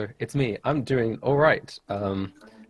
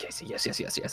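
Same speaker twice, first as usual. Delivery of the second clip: whispered eager yeses; 12 dB quieter than usual. Retold or imitated. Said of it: "And I was like".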